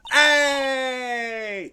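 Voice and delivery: loud high yell; startled shout. Real, not acted. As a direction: blurt it out scared. Clown jump scare outburst.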